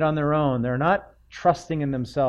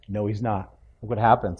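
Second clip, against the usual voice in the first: ordinary talk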